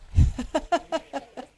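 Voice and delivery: steady run of chuckles; laugh. Real, not acted. Risa espontánea de la profesora. (risa espontánea a carcajaditas)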